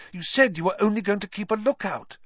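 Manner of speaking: high-pitched accusation; fierce. A narrator's reading. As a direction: scold fiercely without raising the volume. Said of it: "she said fiercely".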